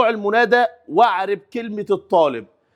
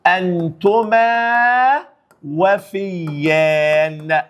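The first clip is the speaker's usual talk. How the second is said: drawn-out words; much slower than usual. Shown as a demonstration. Teacher dictates the dual answer very slowly. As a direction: say أنتما وفيان very slowly, syllable by syllable. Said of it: "فهقول إيه؟".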